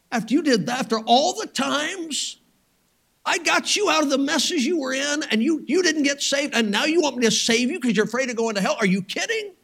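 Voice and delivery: high, incredulous rant; indignant refusal. Acted. Imagined God refusing to save him.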